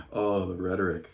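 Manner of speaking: usual speaking voice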